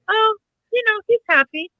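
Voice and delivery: a lightly voiced toddler, casual and content; small child voice. Mother imitating her son's breezy child answer.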